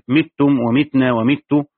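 "متم ومتنا ومت" with an i after each initial م: mittum, mitna, mittu; كسر الميم. Shown as a demonstration. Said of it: "بكسر الميم". ("متم ومتنا ومت" بكسر الميم)

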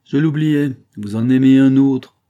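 loud, high, drawn-out taunt; bitter outcry. A narrator's reading. Jealous husband accusing his wife, bitter cry. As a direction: cry it out bitterly at full volume. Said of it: "s'écria-t-il avec un éclat de rire amer".

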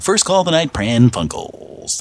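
usual speaking voice

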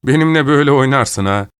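loud, high-pitched outburst; angry shout. A narrator's reading. Danny lashing out at Rivera over the deal, angry shout. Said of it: "diye haykırdı Danny".

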